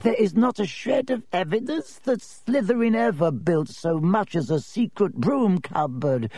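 high, dry, reedy and firm; stern reedy professor. Acted. Professor ends the topic.

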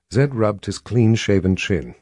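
ordinary delivery

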